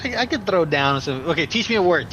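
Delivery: ordinary talk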